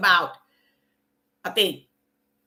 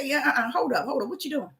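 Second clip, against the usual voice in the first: soft, hushed correcting voice; gently but firmly tell yourself to stop; much softer, low voice saying hold up; quiet inner voice of the Spirit; 4 semitones lower, 10 dB quieter, faster than usual. Retold or imitated. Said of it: "He said".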